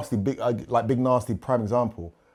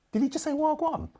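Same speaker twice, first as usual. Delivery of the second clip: high, startled question, imitating an amazed schoolkid; a mock shocked kid asking about TV.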